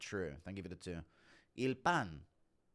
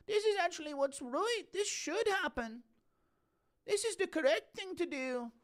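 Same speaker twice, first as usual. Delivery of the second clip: Soy Gary. Acted. Onlooker approving as Dennis gets jumped, very high caricature. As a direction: pitch it way up and hold it there. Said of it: "Impression of Soy Gary watching the Dagestanis".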